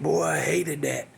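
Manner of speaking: ordinary delivery, nothing marked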